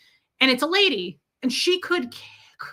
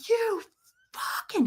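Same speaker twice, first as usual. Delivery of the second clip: high strained mock scream, held back in volume; imitated screaming; 10 semitones higher, 4 dB quieter than usual. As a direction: mimic a screaming man, voice pitched up. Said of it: "he's screaming".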